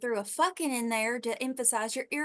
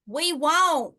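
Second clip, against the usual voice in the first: loud, high call down; 5 semitones higher, 8 dB louder than usual. Acted. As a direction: shout it back down. Answering shout from the window.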